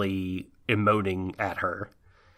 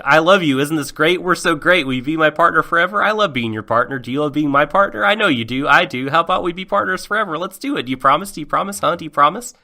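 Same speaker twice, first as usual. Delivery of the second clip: put-on higher, louder pleading boyfriend; clingy needy boyfriend; 6 semitones higher, 6 dB louder, faster than usual. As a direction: push for the promise eagerly. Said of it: "basically just like".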